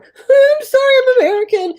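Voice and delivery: very high, loud wail; mock panicked apology. Friend re-enacts fleeing on a scooter, panicked wail. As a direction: squeal the apology in comic panic.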